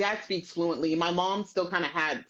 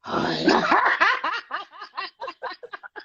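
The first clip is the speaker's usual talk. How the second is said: burst of high laughter; 13 semitones higher, 5 dB louder than usual. Real, not acted. Mother laughing hard at her child's antics. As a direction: laugh out loud, high and bright.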